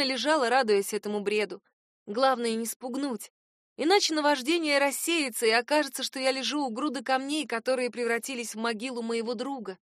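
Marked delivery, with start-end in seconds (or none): none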